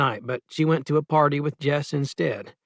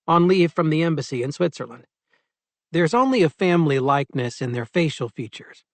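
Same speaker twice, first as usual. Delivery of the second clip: usual speaking voice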